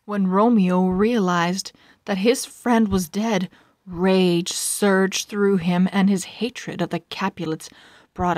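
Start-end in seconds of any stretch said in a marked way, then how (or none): none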